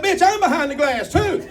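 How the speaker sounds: high-pitched retort posing as politeness; mock polite. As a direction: retell it high, as if perfectly courteous. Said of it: "I said it politely".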